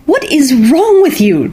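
high, slow, sharp demand; a mother snapping at her daughter; mother demanding an answer from Aino, high and slow. A narrator's reading. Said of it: "demanded their mother".

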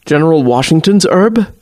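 ordinary delivery, nothing marked

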